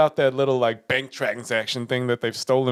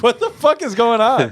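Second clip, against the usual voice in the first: high, louder mock disbelief; bewildered outburst; 8 semitones higher, 5 dB louder than usual. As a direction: act out baffled exasperation. Retold bewildered look at partner.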